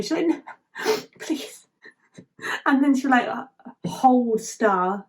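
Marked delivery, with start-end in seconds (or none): 0.3-1.7 high, soft, breaking-up fake sob; badly faked sobbing plea, half laughed; mock sobbing. Asher alone over the fallen star, mock sobbing. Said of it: "like, sobbing".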